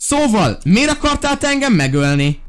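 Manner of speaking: high, indignant questioning; play-indignant demand for answers; mock interrogation. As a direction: grill a game captive, pitch held high. Interrogating the enemy who shot him.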